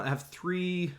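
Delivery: ordinary delivery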